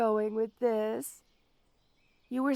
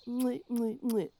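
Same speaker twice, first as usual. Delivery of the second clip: mock kissing noises; 7 dB quieter than usual. Villain mocking victim's date kiss.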